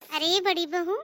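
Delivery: ordinary delivery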